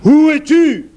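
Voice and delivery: furious ogre bellow, high and loud; roaring shout. A narrator's reading. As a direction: roar it out in rage. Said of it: "il vociféra".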